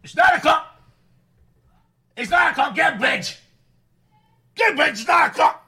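loud high barking yell; shouting over telly. Acted. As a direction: bark orders at the kids. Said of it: "You were literally barking then".

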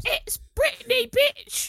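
very high, loud squeaky [name] voice; [name] impression. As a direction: squeal it out like a pop diva. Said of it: "The only part that doesn't have a British accent is".

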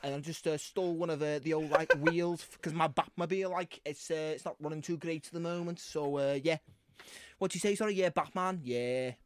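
put-on rambling, casual Scouse accent; Scouse Batman. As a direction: chat away as a Scouse superhero. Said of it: "are you Scouse Batman from The Dark Knight".

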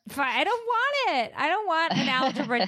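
high whiny squeal of refusal; mock refusal. Mom refusing the kid's textbooks, whiny squeal. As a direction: whine it like a stubborn kid.

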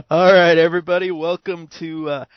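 high, slow announcer voice; hammed-up announcer. Welcoming everybody to the show, slow hammed-up announcer. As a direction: drag out the show name. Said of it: "intro announcement".

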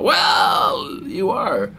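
high, drawn-out mock interviewer gush; long-winded Spanish reporter. TV reporter greeting every player, exaggerated slow gushing. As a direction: stretch it out slowly with pompous enthusiasm. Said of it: "Han gjorde så med alla spelare".

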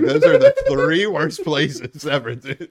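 loud, drawn-out exclamation; emphatic punchline. Retold or imitated. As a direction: blast it out slowly as the verdict. Comic punchline, laughing crowd-style delivery.

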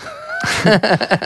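short high laugh. Real, not acted. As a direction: laugh at the memory. Laughing during story.

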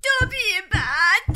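high, slow, loud self-punishing cry; an elf screaming at himself; loud drawn-out elf shriek; shouting. A narrator's reading. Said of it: "فریاد زد".

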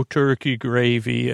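normal talking voice

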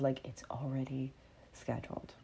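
a soft, calm whisper. Shown as a demonstration. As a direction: breathe it out calmly, with total ease. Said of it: "There's a calm of".

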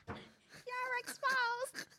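high laughing squeal, slow. Real, not acted. Laughing while teasing.

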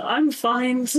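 encouraging smile. Acted. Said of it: "like, an encouraging smile".

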